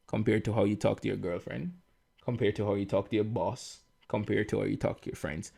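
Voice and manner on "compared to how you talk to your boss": ordinary talk, nothing marked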